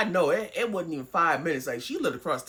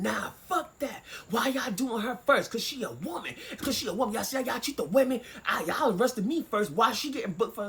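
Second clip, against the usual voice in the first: a touch of a higher, indignant holler; mild mock yell; 6 semitones higher than usual. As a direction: retell the boyfriend ranting from the cell. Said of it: "in the holding cell screaming".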